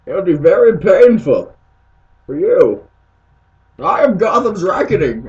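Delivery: loud, menacing villain boast; booming villain threat. Acted. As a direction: proclaim it like a supervillain.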